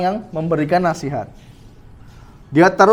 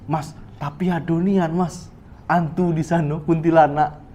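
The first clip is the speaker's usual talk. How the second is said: hushed, slightly slowed objection; softer protest; 3 semitones lower, 6 dB quieter than usual. Acted. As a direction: play a parent gently insisting, voice kept down. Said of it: "Atau lebih halus lagi".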